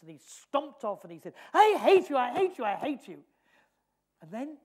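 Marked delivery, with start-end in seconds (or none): 1.5-3.2 put-on high, loud screaming child; shouting I hate you at mother; child's tantrum. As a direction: scream it like a furious little boy stamping. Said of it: "he stamped his feet".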